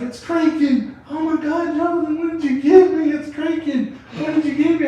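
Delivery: high, loud imitation of panic; panicked high mock girlfriend. Girlfriend panicking about the creaking wall, high frantic voice. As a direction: shriek it like she's freaking out on the edible.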